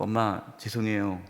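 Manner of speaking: put-on low, soft voice of an apologetic child; meek apology. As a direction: act out a child's subdued apology. Child apologizing for bad grades.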